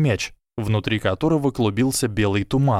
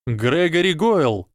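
slow, high call by full name; yell; 6 semitones higher, slower than usual. A narrator's reading. Harry stops the fight by challenging Goyle, drawn-out yell. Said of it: "завопил Гарри".

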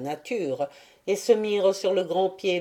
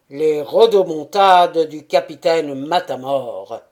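cavernous voice; 8 dB louder than usual. A narrator's reading. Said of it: "de sa voix caverneuse".

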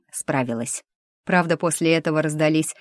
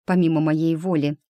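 ordinary delivery

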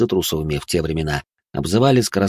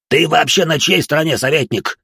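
higher, louder angry snap; snapping angrily; 8 semitones higher, 4 dB louder than usual. A narrator's reading. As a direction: snap at the advisor. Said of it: "окрысился торгаш". (раздражённо огрызаясь)

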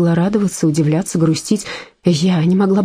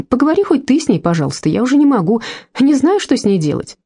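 exasperated husband, voice rising in frustration; weary plea; 6 semitones higher than usual. Acted. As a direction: ask for help, worn out and fed up. Mark pleading to Marta.

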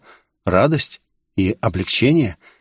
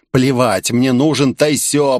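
ordinary delivery, nothing marked

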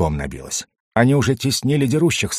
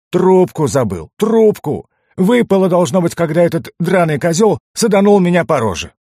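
much higher and louder, wailing over the lost pipe; distressed lament; 8 semitones higher, 4 dB louder than usual. A narrator's reading. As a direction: cry out the loss in dismay. Dwarf lamenting his lost pipe.

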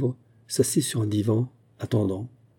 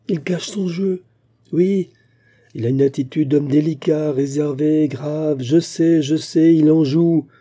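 higher, louder, bitter outburst; agitated denunciation; 5 semitones higher, 4 dB louder than usual. Acted. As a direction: denounce him, voice pitched up and insistent. Bitter wife denouncing her husband. (dénonciation agitée, voix haute et forte)

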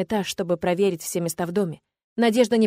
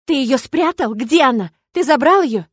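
high, loud panicked yell; frantic yelling; 5 semitones higher, 7 dB louder than usual. A narrator's reading. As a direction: yell at him in terror. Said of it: "истошно воплю я".

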